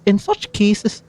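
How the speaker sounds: lightly Scottish Venti voice, playful; a gentle Scottish lilt as the bard; Scottish accent. Acted. Streamer voicing Venti's lines, in a light Scottish accent. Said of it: "Scottish Venti".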